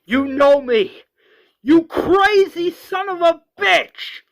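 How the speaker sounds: high, loud acted fury; all-out enraged yell; straight-up screaming. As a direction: scream it, drawing out the insult. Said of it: "Rick straight up screams".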